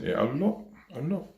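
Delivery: high-pitched little imitation of mum; imitating mum. Mum urges her child on at the playground, lightly imitated high voice. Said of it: "And then Mum said".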